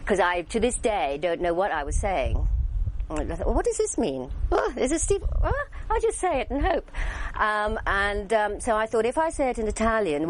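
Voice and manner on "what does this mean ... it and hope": high-pitched mock bewilderment; baffled inner voice. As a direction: puzzle over it in a high voice. Retells own baffled thoughts.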